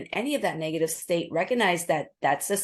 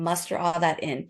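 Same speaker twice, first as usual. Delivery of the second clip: ordinary talk, nothing marked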